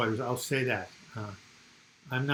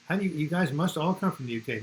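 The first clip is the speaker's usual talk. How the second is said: ordinary talk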